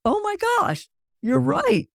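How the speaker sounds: high-pitched "oh my gosh" of sudden realization; surprised realization. Retold or imitated. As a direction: squeal it as the penny drops. Retold realization of misspelling.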